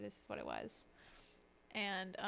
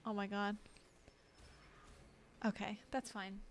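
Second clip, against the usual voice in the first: ordinary delivery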